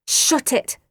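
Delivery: usual speaking voice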